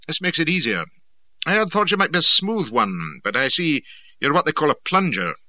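clipped, businesslike officer voice; brisk military gentleman. Acted. Brisk Abercrombie speaks.

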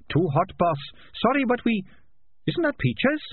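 high-pitched little rat voice; high, alarmed rat character; anxious rat underling. Acted. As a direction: report to the boss in a high, worried voice. Rat reports to boss amid fire.

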